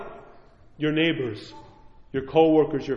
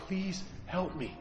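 much softer, slow pleading prayer; quiet plea; 16 dB quieter, slower than usual. Retold or imitated. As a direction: beg God in a hushed voice. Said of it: "Fall on my knees and say".

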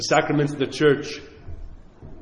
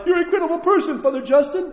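much higher, sing-song mock praise; mock flattery; 11 semitones higher, faster than usual. Priest mocking the praise people expect from God, high and gushing. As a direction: gush like a fawning admirer, mocking it.

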